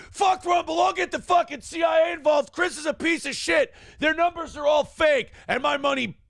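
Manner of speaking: high angry shouted rant. Retold or imitated. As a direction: rant at the top of your voice. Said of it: "So Mersh just goes on the air".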